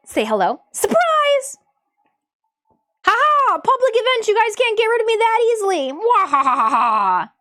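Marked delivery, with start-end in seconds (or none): 0.7-5.9 gleeful villain, much higher and louder; mad scientist. Pretend. Popping in at public event. 5.9-7.3 slow, loud evil cackle; evil laugh. Pretend. Villain gloat after surprise.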